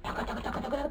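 whispered, quick footstep sounds; whispered taca. Acted. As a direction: tiptoe the steps in a whisper. Mole climbing sound effect.

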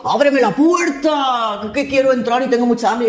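a hungry wolf at the door; wolf demanding. Acted. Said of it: "Y dijo".

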